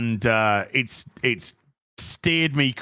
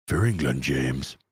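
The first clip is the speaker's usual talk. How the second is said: very low, hushed Yorkshireman trying to speak posh; Sean Bean; 13 semitones lower than usual. Acted. Streamer answering a viewer's request, deep Sean Bean impression. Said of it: "Do you mind doing a Sean Bean impression".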